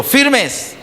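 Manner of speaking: very high barked "Firmes"; commander's order. Shown as a demonstration. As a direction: call the congregation to attention. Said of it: "Hagamos el ensayo".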